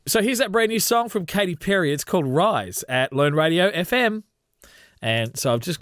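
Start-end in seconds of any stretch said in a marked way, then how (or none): 0.1-4.2 upbeat radio announcer read, pitched high. Shown as a demonstration. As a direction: sell it like a breezy DJ intro. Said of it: "I'm gonna record an intro break".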